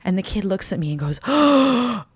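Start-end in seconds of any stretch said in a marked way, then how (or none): 1.2-2.0 big gasp, then drawn-out amazement; mock child's awe, word by word; imitated awestruck kid. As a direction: gasp and marvel like a small child. Awestruck kid seeing the arm.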